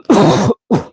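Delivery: sharp cough. Real, not acted. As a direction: cough mid-sentence. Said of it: "Talking without catching my breath".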